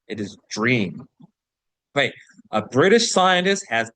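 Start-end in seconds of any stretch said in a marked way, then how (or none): 0.5-1.1 "dream" begun with a j sound, "jreem"; low-pitched model of "dream" as "jreem". Shown as a demonstration. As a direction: start "dream" with j, not ch. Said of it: "It is the latter".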